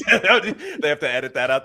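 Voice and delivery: ordinary talk, nothing marked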